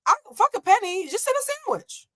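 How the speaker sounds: put-on squeaky child insisting; mock child whine; high kid voice. Kid demanding sandwiches.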